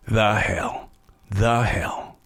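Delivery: low, drawn-out disbelief; scornful younger self. Acted. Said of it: "little peep squeak would look at me and say".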